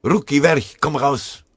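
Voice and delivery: loud, high battlefield yell; shouted military order; roared command. A narrator's reading. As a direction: bellow an order at a hidden enemy. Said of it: "Eine ihm bekannte Stimme brüllte".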